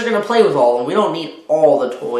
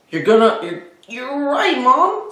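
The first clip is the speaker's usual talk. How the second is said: put-on higher boy's voice called from far; boy calling out; 9 semitones higher than usual. Spencer calls to his mother from his bedroom, higher. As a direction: call back from another room. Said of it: "Spencer called from his bedroom".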